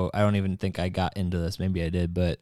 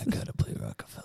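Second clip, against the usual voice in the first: fast whispered much lower and softer imitation; whispered Baldwin impression; 11 semitones lower, 9 dB quieter, faster than usual. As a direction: hiss it out quickly, copying the Baldwin voice. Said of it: "why did I make that his voice?".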